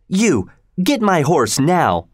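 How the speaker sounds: slow, high, bossy command; ordering. A narrator's reading. Girl ordering the maid.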